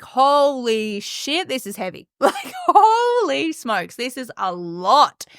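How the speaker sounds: drawn-out overwhelmed "holy" exclamations; overwhelmed disbelief. Acted. New clients hit by the heavy reality, overwhelmed disbelief. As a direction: stretch the curse out in mock shock.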